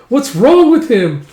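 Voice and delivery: high, loud, slow complaint; aggrieved kid's cry; indignant yell. Acted. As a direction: draw it out in loud disbelief. Child baffled by Thorfinn's attack.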